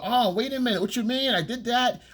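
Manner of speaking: high, mocking whiny excuse voice; mock girlfriend excuse. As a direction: mimic a girlfriend making excuses, high-pitched. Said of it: "she go".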